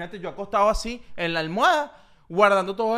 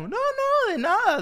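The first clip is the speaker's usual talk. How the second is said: high, thin innocent voice; thin little voice; 4 dB louder, slower than usual. Retold or imitated. Imitating the cohost dodging questions, high thin voice. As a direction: squeak it out, hiding a secret. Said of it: "Y pones esa voz finita".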